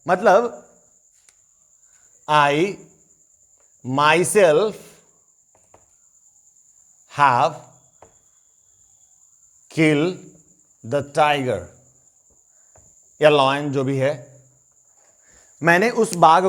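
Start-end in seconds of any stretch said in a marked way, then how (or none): 2.3-11.6 "myself" stressed, each word set apart by pauses; emphatic, word by word. Shown as a demonstration. English version of the hunter's boast, word by word with stress. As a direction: spell it out word by word, leaning on "myself". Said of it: "मैं इस बात पे जोर दे रहा हूं".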